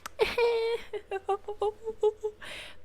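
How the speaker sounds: high-pitched drawn-out giggle; high silly laughing trill. Real, not acted. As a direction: let out a long, high laugh. Wordless noise after Mérida remark.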